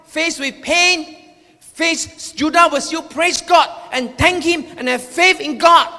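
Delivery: ordinary talk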